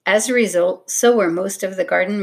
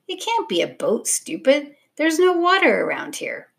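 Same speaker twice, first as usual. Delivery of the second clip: scoff, getting higher as you go; scornful disbelief; 7 semitones higher than usual. A narrator's reading. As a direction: pitch climbing in incredulous protest. Tom scoffs at Stella's idea.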